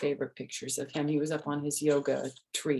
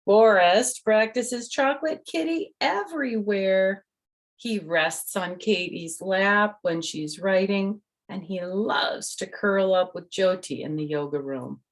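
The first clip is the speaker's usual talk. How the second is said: bright storybook reading voice; reading to children; 3 semitones higher, 6 dB louder than usual. Acted. The author reads her book to the kids, bright storytelling. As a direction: read it out to little listeners.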